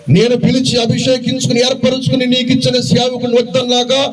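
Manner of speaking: preaching at full force. Acted. Preacher to his congregation, lifted preaching voice. Preacher voicing God, then scripture.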